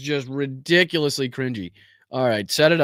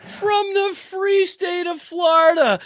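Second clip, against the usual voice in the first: much higher, slow mock announcer; mock anchor; 12 semitones higher, slower than usual. Streamer mocking the governor's introduction, slow high mock anchor. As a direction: drawl it like a pompous host.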